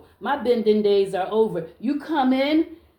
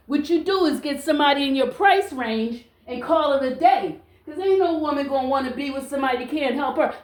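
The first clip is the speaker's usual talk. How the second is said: normal talking voice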